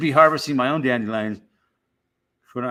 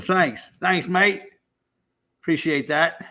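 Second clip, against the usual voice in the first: higher-pitched put-on Aussie accent; playful Australian accent, pitched up; 4 semitones higher, slower than usual. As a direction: accept praise in a mock Australian voice. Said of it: "done a good Australian accent".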